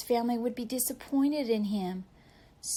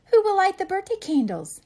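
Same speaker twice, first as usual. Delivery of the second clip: high, louder motherly question; mother asking; 10 semitones higher, 8 dB louder than usual. Acted. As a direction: ask brightly like an eager mom. Mother asks at birthday cake.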